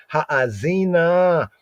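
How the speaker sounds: Ha'azina said slowly with a long first a, haa-zee-na; drawn-out Hebrew word. Shown as a demonstration. Teacher sounding out the Hebrew for students, slow and drawn out. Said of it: "broaden the ear".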